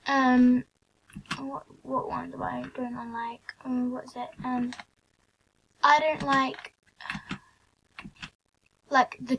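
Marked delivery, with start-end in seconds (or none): 1.4-4.7 whispered question to herself; whispering. Real, not acted. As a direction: whisper it while thinking. Muttering to herself mid-game.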